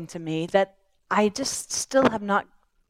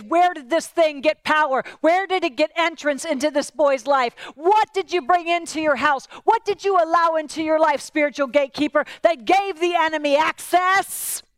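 higher and louder, pressing questions; forceful Jesus; 6 semitones higher, 3 dB louder than usual. Acted. Jesus confronting the father about open doors, higher and forceful. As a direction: confront him with each question.

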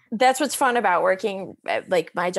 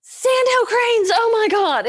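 much higher, louder, drawn-out gasp of delight; thrilled outburst; 8 dB louder, slower than usual. Retold or imitated. Vet driving home spots sandhill cranes, high thrilled outburst. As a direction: blurt it like you just saw the birds.

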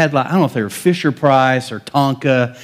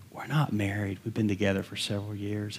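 quiet confession; 3 semitones lower, 13 dB quieter than usual. Retold or imitated.

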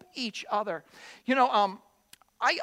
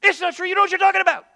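raised, loud insistent denial; upset denial; 6 semitones higher, 7 dB louder, faster than usual. Pretend. Upset sibling insisting loudly it's not true.

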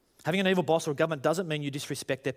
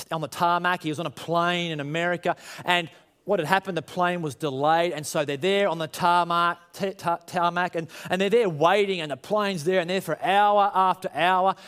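ordinary talk

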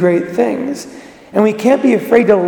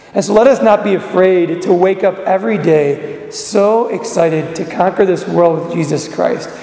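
ordinary talk

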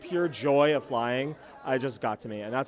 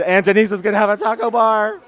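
loud, higher mock-excited announcement; imitating Robin's excitement; 5 semitones higher, 6 dB louder than usual. Host mimicking a friend who grabbed the mic, excited. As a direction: crow it loudly, mimicking a thrilled friend. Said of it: "Robin just grabbed the mic".

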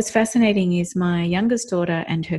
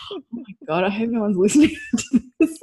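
laughing mock alarm; 4 semitones higher than usual. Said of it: "I'm, like, sitting there going".

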